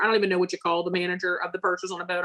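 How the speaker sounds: ordinary delivery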